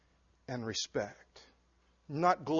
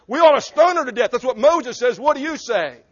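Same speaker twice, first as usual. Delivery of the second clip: a touch of a mob accuser, higher and louder; accusing demand; 7 semitones higher, 9 dB louder than usual. Acted. Pharisees challenging Jesus over the woman, demanding. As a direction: demand it like a self-righteous accuser.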